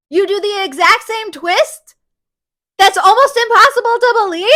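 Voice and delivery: mock outrage at the author, climbing to a yell; exasperated rant. Reviewer scolding the author over the twist, building to an outraged yell.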